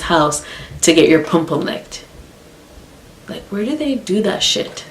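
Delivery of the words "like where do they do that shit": high, hushed disbelief; incredulous. Real, not acted. Storyteller baffled by best friend's behaviour, quietly incredulous. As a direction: ask it softly, pitch lifted in disbelief.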